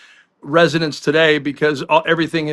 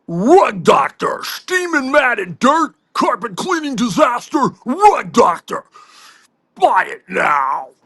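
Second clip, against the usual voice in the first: over-the-top commercial pitchman, slowed and drawn out; ad announcer; 7 semitones higher, slower than usual. Pretend. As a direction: belt out a spoof sponsor spot, stretching the product name. Spoof sponsor ad read.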